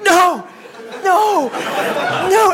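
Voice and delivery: high, loud, laughing mock cries of No; Michelle's dying cries. Retelling how Michelle screamed in the burning field, hammed-up loud cries.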